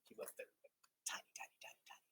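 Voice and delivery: breathy little whisper. Acted. Teacher whispering to the class about tiny stars.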